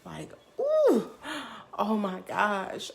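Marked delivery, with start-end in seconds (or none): 0.6-1.1 high, loud "ooh"; rush-of-energy whoop. Retold or imitated. Storyteller reliving a rush of energy, high whooping ooh. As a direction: whoop as if a jolt runs through you. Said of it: "I feel this rush of energy".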